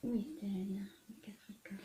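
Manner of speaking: barely audible whisper while searching; absent-minded whispered question; whispered to oneself. Real, not acted. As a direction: murmur it under your breath, almost voiceless. Searching, talking to herself.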